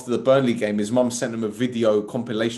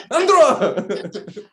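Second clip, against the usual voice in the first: high, loud mock yell; imitating someone's mum; 9 semitones higher, 6 dB louder than usual. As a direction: bellow the kid's name like a hammed-up mum. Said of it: "imagine his mum talking like that".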